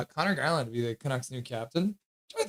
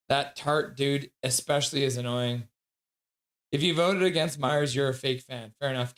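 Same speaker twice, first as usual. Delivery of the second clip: usual speaking voice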